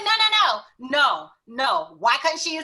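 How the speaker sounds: loud, high, outraged protest; indignant partner. Retold or imitated. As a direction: play him fuming at the clerk. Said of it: "and he was livid".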